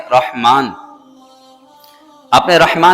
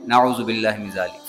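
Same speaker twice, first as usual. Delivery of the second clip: much softer, lower disclaimer; hushed pious aside; 5 semitones lower, 8 dB quieter than usual. Real, not acted. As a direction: drop the voice to disown it. Pious aside disowning quoted blasphemy. (دبی آواز میں استغفاری جملہ)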